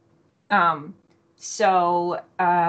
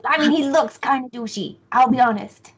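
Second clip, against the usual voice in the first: blunt character voice; 6 semitones higher, 4 dB louder than usual. Acted. Player gives character's blunt verdict on stranger.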